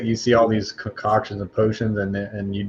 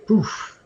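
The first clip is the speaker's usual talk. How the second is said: poof sound; 12 semitones higher than usual. Acted. Potion blows up in face.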